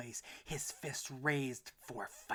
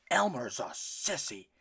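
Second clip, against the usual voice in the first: louder angry papa; bellowing father; 3 semitones higher, 11 dB louder than usual. A narrator's reading. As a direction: scorn the son's running. Papa scornful.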